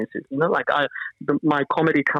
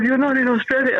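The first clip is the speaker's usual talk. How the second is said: higher-pitched heckle, lightly acted; retold heckler; 10 semitones higher than usual. Comedian repeats the audience member's correction, mock heckle. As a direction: imitate someone calling up at the stage.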